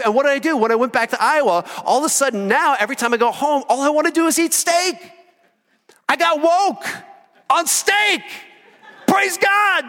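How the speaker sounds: rising sermon shout; full-force preaching. Acted. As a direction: preach it out to the whole room.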